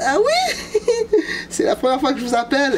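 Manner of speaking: laughing through it. Retold or imitated. Laughing while quoting himself on phone.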